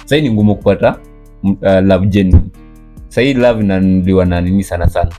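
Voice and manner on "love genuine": ordinary talk, nothing marked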